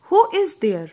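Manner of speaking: high, loud call through the door; rosebud asking. Acted. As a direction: call out who's knocking. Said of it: "asked the little rosebud". (ऊँची आवाज़ में पुकारती कली)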